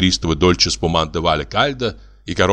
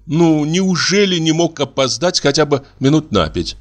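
indignant; 8 semitones higher than usual. A narrator's reading. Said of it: "мысленно возмутилась Нюра".

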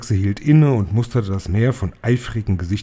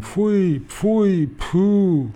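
high, repeated disgusted cries; high, disgusted crowd cry; crowd yelling; 9 semitones higher, much slower than usual. Acted. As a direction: jeer it high like a witch mob. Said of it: "schrie das Publikum".

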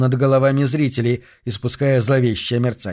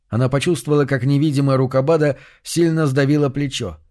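plain reading voice

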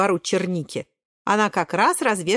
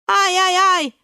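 high, loud "ai-ai-ai"; alarmed yelping; 11 semitones higher, 6 dB louder, slower than usual. Acted. Брусничка and Клюковка falling in, high yelps. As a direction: squeal in a run of yelps.

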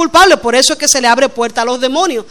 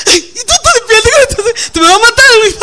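much higher, laugh-cracked frightened cry; startled husband; 8 semitones higher than usual. Retold or imitated. Husband startled by wife with a bat at night, high laughing cry. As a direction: squeal it like a scared man caught awake.

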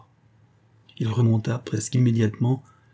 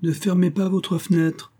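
pitched up, insolent call; higher, almost insolent; 8 semitones higher than usual. A narrator's reading. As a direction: taunt him from below. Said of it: "d'un ton plus haut et presque insolent". (ton plus haut, presque insolent)

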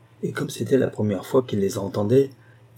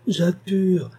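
much higher, rising wail of "Jade pure"; an old woman crying after her granddaughter; wailing plea; 8 semitones higher than usual. Acted.

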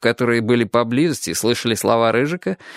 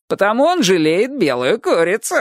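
high, gleeful jeer; high-pitched kid mockery; teasing schoolboy; 8 semitones higher than usual. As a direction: deliver the taunt as a hilarious punchline. Schoolboy finishes his taunt.

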